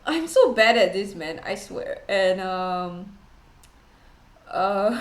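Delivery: ordinary delivery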